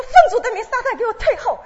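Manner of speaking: very high, loud rebuking shout. Retold or imitated. Rebuking Satan as she once did, a loud laughing shout. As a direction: yell it at Satan, half laughing. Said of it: "我就喊起来".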